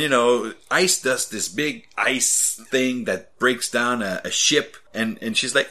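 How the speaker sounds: ordinary talk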